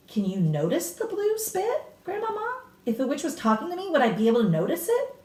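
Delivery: put-on high, eager boy's voice, quickening; a curious child pressing his grandmother; boy anxiously asking his grandmother; eager young boy. A narrator's reading.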